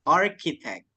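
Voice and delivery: "Architect" sounded with a K for CH; CH dibaca K. Shown as a demonstration. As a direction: model "Architect" clearly, hard K in the middle. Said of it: "C H-nya kita baca K". ("Architect" dengan CH berbunyi K)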